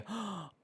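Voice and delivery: sharp delighted gasp; quick delighted intake of breath. Real, not acted. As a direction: catch your breath at something pretty. Sound: delighted gasp at item.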